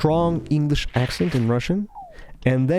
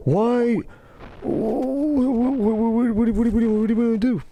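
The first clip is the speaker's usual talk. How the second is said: high, stuttering mock panic; flustered stammer; 8 semitones higher than usual.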